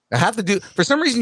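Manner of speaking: ordinary talk, nothing marked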